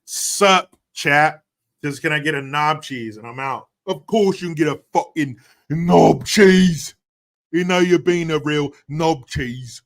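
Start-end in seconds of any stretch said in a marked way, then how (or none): 3.9-7.0 loud, hammed-up British accent; exaggerated British voice, pitched up and loud. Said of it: "when you say it in that accent". 7.5-9.8 loud, higher put-on British accent; hammed-up British voice, loud and teasing. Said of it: "when you say it in that accent".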